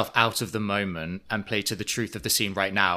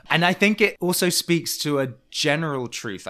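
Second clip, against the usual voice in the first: ordinary talk, nothing marked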